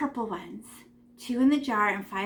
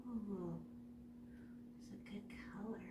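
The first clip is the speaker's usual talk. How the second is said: soft whispered praise; whispering; 3 semitones lower, 24 dB quieter than usual. Real, not acted. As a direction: whisper it to yourself. Keeping quiet while painting.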